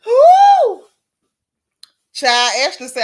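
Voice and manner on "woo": high, very loud joyful whoop. Real, not acted. Host reacting to a chat joke with a whoop. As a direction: throw your head back and whoop.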